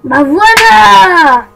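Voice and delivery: slow, loud, drawn-out "voilà"; triumphant. Real, not acted. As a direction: draw out the big reveal. Triumphant reveal after F12.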